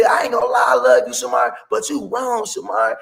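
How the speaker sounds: high, loud mimicry of a pleading woman; shrieky imitated scolding; upset woman. Voicing the lady scolding Shamar.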